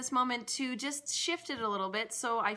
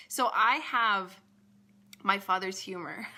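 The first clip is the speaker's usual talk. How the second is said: usual speaking voice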